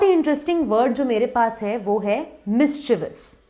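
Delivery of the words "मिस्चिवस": मिस्चीवियस in three syllables; no "ee-us" ending on मिस्चीवियस; correct pronunciation. Shown as a demonstration. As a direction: say मिस्चीवियस as "MIS-chi-vus". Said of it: "तो ये है मिस्चीवियस".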